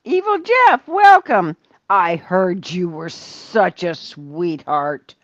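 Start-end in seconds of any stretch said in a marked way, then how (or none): 0.1-1.6 excited welcome. Real, not acted. Greeting a viewer in chat.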